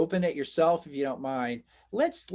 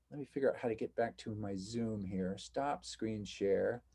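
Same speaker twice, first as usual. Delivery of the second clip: soft, lower aside to self; muttering to self; 4 semitones lower, 10 dB quieter than usual. Real, not acted. Teacher getting back to Zoom, muttering to self. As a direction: think aloud quietly while working the controls.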